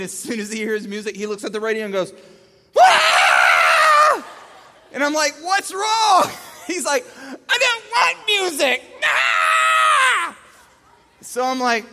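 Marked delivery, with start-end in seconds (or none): 2.8-4.2 very loud, high mock shriek; crying-toddler scream. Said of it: "the crying starts". 5.4-6.3 loud, high question over screaming; retold dad at wit's end; exasperated shout. 7.5-10.4 loud, high mock-crying wail; crying toddler. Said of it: "the crying starts".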